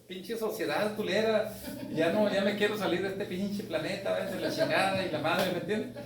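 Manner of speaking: ordinary delivery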